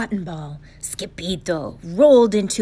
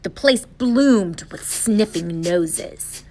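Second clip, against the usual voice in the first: ordinary delivery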